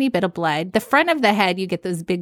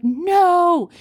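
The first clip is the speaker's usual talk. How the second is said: high, drawn-out NO; alarmed mock protest; retold panicked denial; 9 semitones higher, 4 dB louder than usual. Mom realizing her toddler is missing, panicked denial. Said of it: "And I start panicking".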